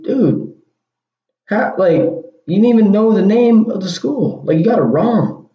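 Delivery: can't believe he got it wrong; exasperated disbelief. Real, not acted. Disbelief at friend's wrong school.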